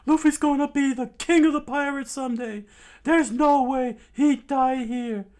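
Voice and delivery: fast, high, loud yell; defiant shout. Acted. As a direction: shout it with total conviction.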